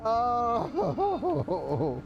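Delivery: airy laugh up high, then an "oh"; high breathy laugh. Real, not acted. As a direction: let out a high, unvoiced laugh. Laughs at own frustration.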